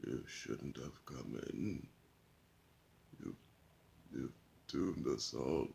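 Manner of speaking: put-on low, slow, hushed voice of a doomed old man. Old man warning Lobo who walked in, low and slow.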